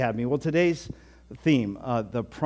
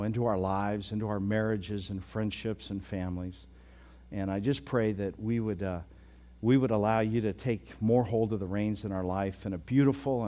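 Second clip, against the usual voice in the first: prayer; 4 semitones lower than usual. Real, not acted. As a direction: pray sincerely, settling lower.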